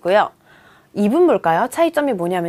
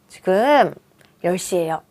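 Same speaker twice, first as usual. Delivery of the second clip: ordinary talk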